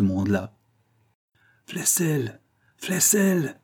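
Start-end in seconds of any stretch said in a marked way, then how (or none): 1.7-3.6 hint of a growl in a hushed call; muffled, growling. A narrator's reading. Hidden man calls out behind the provost, muffled growl. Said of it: "dit une voix sourde et grondante".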